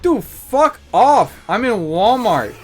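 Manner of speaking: loud, high, drawn-out brush-off; slow, emphatic retold comeback; exasperated retort. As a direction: act out fed-up annoyance, stretching the words. Acting out his annoyed retort.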